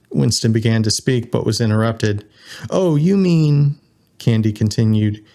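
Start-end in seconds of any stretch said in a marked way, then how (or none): 2.7-3.8 slow, higher mock realization; pointedly playing along; knowing pretense. A narrator's reading. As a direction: stretch it out as if suddenly understanding. Candy interrupts, feigning innocent understanding.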